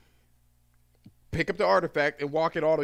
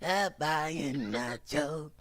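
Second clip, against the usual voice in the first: slow, soft monster voice; monster-like woman; 6 dB quieter, much slower than usual. Retold or imitated. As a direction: drag it out in a wrecked monster voice. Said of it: "why you sounding like that?".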